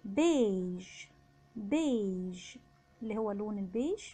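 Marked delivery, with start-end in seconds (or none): 0.0-2.6 "بيج" drawn out with a long e; low, slow French "بيج", beige; EI as open e. Shown as a demonstration. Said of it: "بعده حرف الإي بيتنطق إي".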